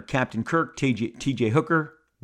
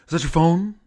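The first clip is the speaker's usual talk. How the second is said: higher, louder put-on voice, rising question; William Shatner; 5 semitones higher, 5 dB louder than usual. Second try at Shatner asking about the phone, hammed-up impression. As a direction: push the impression harder, pitch it up. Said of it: "Let me try it again".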